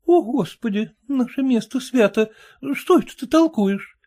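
high frightened wife; frightened exclamation. Acted. Wife frightened by talk of typhus, high alarmed cry. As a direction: cry out in alarm, crossing herself. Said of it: "воскликнула в испуге жена".

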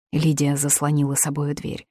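ordinary delivery, nothing marked

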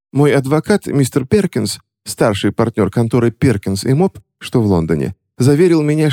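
name-drop with feigned distress; high-voiced scheming lady. A narrator's reading. Witch persuading the clerk with a lawyer's name.

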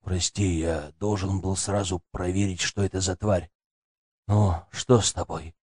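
soft, low and tender; gentle apology. A narrator's reading. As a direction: comfort her quietly. Grandfather comforts crying girl. (мягко и виновато)

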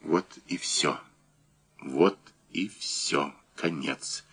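low, very soft, halting; quiet resignation. A narrator's reading. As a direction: let it sink into hushed finality. A doomed man's despairing thought. (тихо, обречённо)

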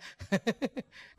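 amused chuckle; tertawa. Real, not acted. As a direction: chuckle at your own story. Tertawa atas ceritanya sendiri. (tawa geli)

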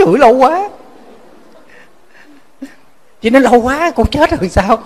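high, loud dismayed cry of how long; wife protesting the monk's advice, high and aghast; shocked wife. Retold or imitated.

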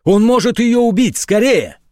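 panicked shouting. A narrator's reading. Dobrodeev reports the burglar to the major, panicked shouting. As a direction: yell the news in a rush.

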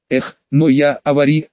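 ordinary delivery

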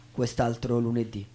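ordinary delivery

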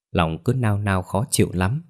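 ordinary delivery, nothing marked

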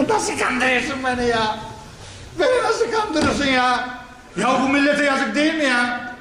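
indignant reproach. Real, not acted. Preacher indignantly addressing deceiver.

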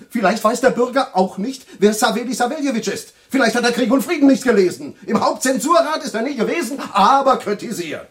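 indignant. Acted. Indignant rant about the critic.

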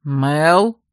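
mildly worried call; a questioning call with a touch of alarm. A narrator's reading. Ler calls out for the heroine, mildly alarmed. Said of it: "встревоженный голос Лера".

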